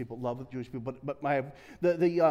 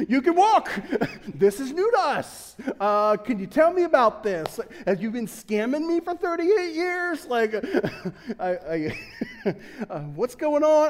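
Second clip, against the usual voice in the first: mock astonishment, higher and louder, laughing in between; amazed dude; 6 semitones higher, 4 dB louder, slower than usual. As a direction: play a stunned bystander who can't believe it. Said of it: "my first question would be like".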